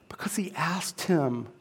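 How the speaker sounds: hushed whisper; unvoiced whisper of a secret. Real, not acted. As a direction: breathe it like a secret. Whispered revelation.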